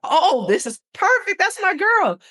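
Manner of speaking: retelling joy at the caseworker's name, high and loud; excited cry. Retold or imitated. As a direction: burst out with proud delight. Retold joy at learning the name.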